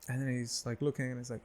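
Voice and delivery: ordinary delivery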